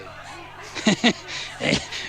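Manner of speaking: high, wheezy snickering laugh; villain's snicker. A narrator's reading. Wolf circling with the shotgun, snickering maniacally.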